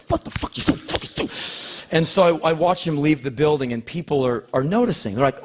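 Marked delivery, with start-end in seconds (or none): 0.1-1.3 a private mocking whisper; whispered aside. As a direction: whisper it at yourself, scornful. Mocking himself under his breath.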